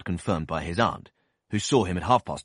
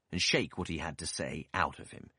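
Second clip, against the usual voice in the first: usual speaking voice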